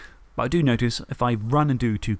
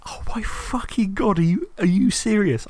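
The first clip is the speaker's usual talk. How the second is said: much higher, slower, half-wailed outrage at the dice; stunned disbelief; 9 semitones higher than usual. Retold or imitated. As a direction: replay the moment you can't believe the roll. Inner disbelief at opponent's bad luck.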